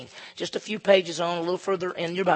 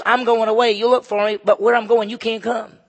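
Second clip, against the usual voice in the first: Jesus' words proclaimed; 4 semitones higher, 7 dB louder than usual. Acted. Pastor voicing Jesus to the religious leaders, solemn and loud. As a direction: declare it like a prophecy.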